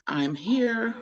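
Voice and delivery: usual speaking voice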